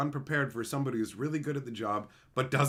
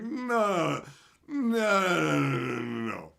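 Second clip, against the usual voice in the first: drawn-out refusal; 4 semitones higher, 3 dB louder, much slower than usual. Retold or imitated. Refusing to go back to work.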